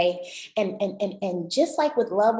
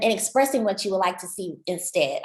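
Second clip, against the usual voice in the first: normal talking voice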